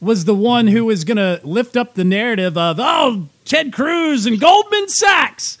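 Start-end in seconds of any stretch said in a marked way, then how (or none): 2.8-5.6 mock outrage. Host mimicking critics of Ted Cruz and Goldman Sachs, mock outrage. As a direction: mimic an alarmist critic, pitched well up.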